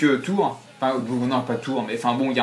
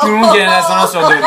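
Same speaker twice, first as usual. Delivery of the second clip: higher, louder, laughing like a scheming villain; machiavellian laugh; 6 semitones higher, 8 dB louder than usual. Acted. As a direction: cackle it out with evil glee. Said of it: "Le rire machiavélique".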